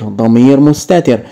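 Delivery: ordinary talk, nothing marked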